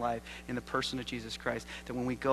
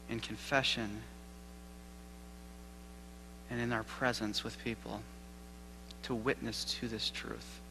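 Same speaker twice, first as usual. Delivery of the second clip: hushed prayer with long pauses; 2 semitones lower, 7 dB quieter than usual. Real, not acted. Preacher asking God for courage to witness, quiet prayer. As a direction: pray softly and unhurriedly, leaving space between phrases.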